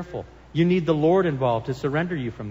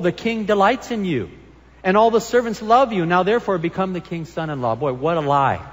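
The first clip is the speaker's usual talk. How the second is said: normal talking voice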